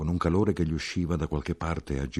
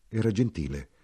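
ordinary delivery, nothing marked

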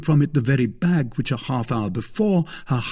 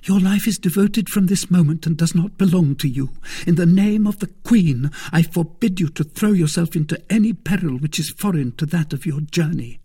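high, louder, urgent woman's voice; commanding young woman; 8 semitones higher, 4 dB louder than usual. Acted. Woman forbidding the fight in the Queen's name, urgently commanding. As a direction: forbid it forcefully, pitched up.

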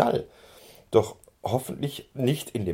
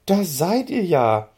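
higher, louder fond telling-off; loving scolding; 7 semitones higher, 6 dB louder than usual. A narrator's reading. Lotte finds the ponies in the stable, fond loud scolding. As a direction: scold the runaways with affection. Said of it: "schimpft sie liebevoll".

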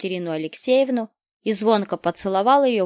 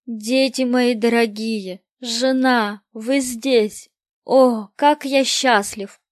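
louder, higher, slow overjoyed greeting; joyful exclamation; 4 semitones higher, 7 dB louder, slower than usual. Acted. Father greets his wife and children, a touch louder and higher, slow and joyful. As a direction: pour out the joy of reunion. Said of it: "воскликнул высокий человек".

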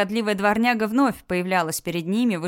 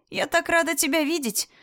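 joyful; 9 semitones higher than usual. Acted. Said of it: "обрадовалась Матильда".

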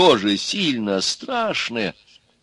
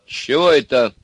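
higher-pitched cross question; angry; 6 semitones higher, 4 dB louder than usual. A narrator's reading. Daughter questions noises from the oven, angry. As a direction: demand an explanation crossly. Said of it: "зло спросила дочка".

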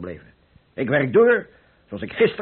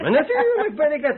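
laughing; 10 semitones higher than usual. Acted. Laughing reply to teasing.

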